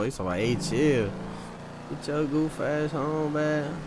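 normal talking voice

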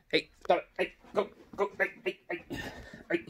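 short, sharp calls to noisy dogs; calling off the dogs. Real, not acted. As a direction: call out to make the dogs stop.

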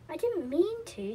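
understated near-tears, drawn out; almost in tears. A narrator's reading. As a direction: plead it slowly, a touch tearful. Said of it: "almost in tears".

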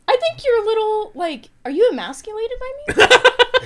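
laughing tease. Real, not acted. Teasing a friend.

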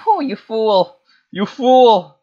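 high, loud gloating cry; mock taunt. Player taunting a foe in the game, high mock shout.

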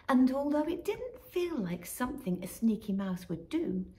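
plain reading voice

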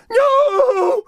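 loud despairing cry; falling scream. Acted. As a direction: wail as you plunge down. Magic goes wrong.

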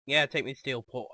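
ordinary talk

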